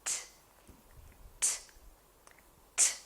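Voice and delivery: crisp "T" like a racket hitting a ball; t sound. Shown as a demonstration. Teacher showing children the tennis ball t sound. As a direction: tap out "t" in short clicks. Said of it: "was the sound".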